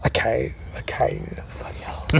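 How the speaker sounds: muttered whispered surrender. Real, not acted. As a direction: give in under your breath. Caving in under breath.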